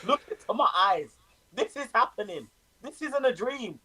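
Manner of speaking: high, wide-eyed insistence; giddy disbelief. Real, not acted. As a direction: plead that it's really true. Insisting it is real.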